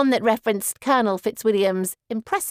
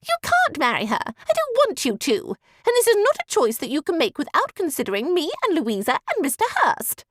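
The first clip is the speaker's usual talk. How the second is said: much higher whining lady's voice; high petulant protest; 8 semitones higher than usual. A narrator's reading. Miss Bingley still protesting.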